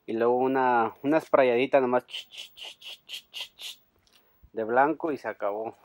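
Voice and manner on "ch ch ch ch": ordinary delivery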